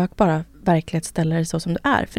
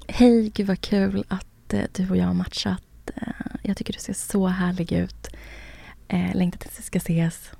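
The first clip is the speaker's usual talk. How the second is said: flirt voice; 4 dB quieter than usual. Shown as a demonstration. Demo for friend of her Tinder match message, flirty whisper. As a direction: whisper sweetly to lure him in. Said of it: "kan du prata lite mer med den där, eh, flörtrösten".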